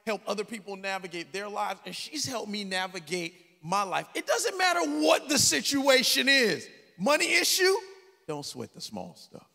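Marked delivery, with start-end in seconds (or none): 4.1-7.7 loud, high, half-sung preaching; preaching at full force. Acted. Preaching build-up. 8.3-9.5 lower, softer and slower, a settled mother; calm mother's advice. Retold or imitated. Mother's calm motto voiced.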